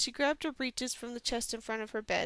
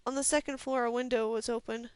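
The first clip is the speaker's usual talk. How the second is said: ordinary delivery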